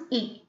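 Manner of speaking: brief "E", not held; short E. Shown as a demonstration. Teacher introducing the next vowel symbol, short E. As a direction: say "E" short, without prolonging. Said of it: "I'm not prolonging".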